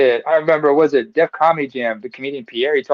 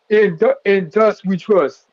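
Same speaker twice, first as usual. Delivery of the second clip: slow, drawn-out and higher; slow announcement; 5 semitones higher, much slower than usual. Real, not acted. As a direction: announce the name with weight. Reads his name slowly.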